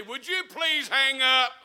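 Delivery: loud, drawn-out annoyed plea; hammed-up exasperation at an eavesdropper; exasperated request. Phone caller to someone listening in on the party line, exasperated.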